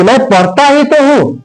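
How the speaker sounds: ही तो pushed up high with force; emphatic stress. Shown as a demonstration. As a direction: insist on ही तो हूं, rising hard. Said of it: "जोर देकर बोलूंगा".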